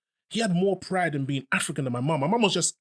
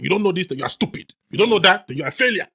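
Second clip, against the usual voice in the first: scolding parent; 3 semitones higher, 8 dB louder than usual. Retold or imitated. Parents scolding child, quoted.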